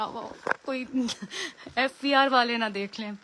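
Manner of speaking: real giggle breaking into the words; amused, laughing as you talk. Real, not acted. Joking to live viewers about a dollar-filled room, laughing. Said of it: "आज मुझे हंसी आ रही है".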